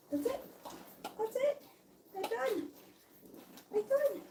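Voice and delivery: high soft praise. Real, not acted. Praising the puppy after its nails are trimmed. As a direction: tell the pup it's over, sweetly. Finishing nail trim with puppy.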